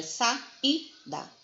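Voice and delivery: slow "sa-i-da", each syllable on its own; separado em sílabas. Shown as a demonstration. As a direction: break "sa-i-da" into three, with the "i" standing alone. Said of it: "Se eu for separar a sílaba". ("sa-i-da" bem devagar, separando as sílabas)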